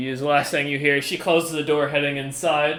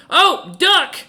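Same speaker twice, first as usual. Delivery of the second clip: high, louder startled exclamation; surprised yelp; 13 semitones higher, 6 dB louder than usual. Real, not acted. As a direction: blurt it out in surprise. GM reacts to roll.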